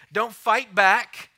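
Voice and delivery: forceful slow warning to the church; loud firm command. Real, not acted. Preacher's strong command.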